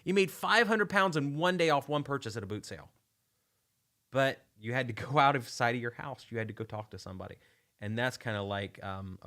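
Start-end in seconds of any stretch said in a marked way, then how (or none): 0.0-2.9 high, loud retold astonishment; acted amazement. Host replying to a follower's boot-sale profit, high amazement. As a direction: act amazed at the huge profit. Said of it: "And I'm like".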